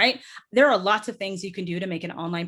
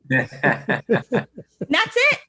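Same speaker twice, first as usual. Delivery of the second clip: ordinary delivery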